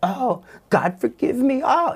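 mocking demonic voice. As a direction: ape his tears in a jeering falsetto. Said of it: "mocking me and mocking my tears".

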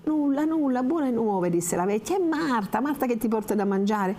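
high, sugary reassurance; calm the girl down, pitched high; reassuring old woman. Acted. (la vecchia rassicura Lucia con voce acuta)

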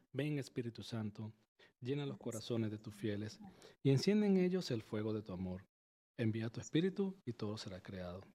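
soft, steady recited prayer to the Holy Spirit; gentle devotional recitation. Acted. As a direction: lead the audience in prayer, softly. Host leads Holy Spirit prayer.

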